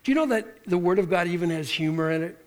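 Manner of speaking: normal talking voice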